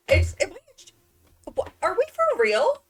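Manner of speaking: high, loud, slowed disbelief; can't believe what she's seeing; stunned, high-pitched outrage. Real, not acted. Mob respawned in her house.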